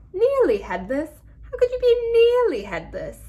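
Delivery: high, loud mock British accent, incredulous; Emma Watson British accent. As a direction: do a put-on posh-girl British accent, disbelieving, pitched high and loud. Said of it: "My impression of a British accent".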